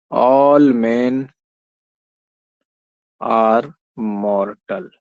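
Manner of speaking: slow, each word set apart by pauses; slow dictation. Shown as a demonstration. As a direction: lay the statement out word by word. Reads the first statement aloud. (रुक-रुक कर लिखवाना)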